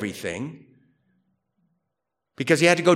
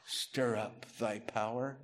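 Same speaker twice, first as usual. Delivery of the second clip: slow, quiet, questioning echo; puzzled repeat; 4 semitones lower, 16 dB quieter than usual. Shown as a demonstration. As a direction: repeat it as if it sounds strange. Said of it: "That sounds weird, huh?".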